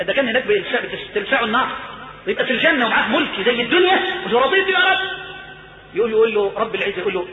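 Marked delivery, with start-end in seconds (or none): 4.4-5.0 high exclamation of delight; overjoyed acceptance. Acted. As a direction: cry out as a servant overwhelmed by the gift. العبد مذهول بملك الدنيا.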